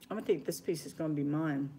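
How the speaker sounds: ordinary talk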